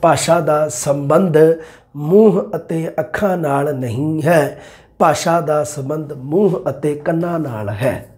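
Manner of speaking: slow, deliberate correction with ਮੂੰਹ stressed; teacher slowing down to correct a mistake. Shown as a demonstration. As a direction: spell it out slowly for the class. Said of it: "ਇਹ ਨਹੀਂ ਗਾ ਬਾਈ ਤੁਹਾਡਾ".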